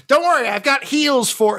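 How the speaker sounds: high-pitched character yell; shouted battlefield call. Acted. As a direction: yell it out high over the fighting. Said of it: "Grum yells".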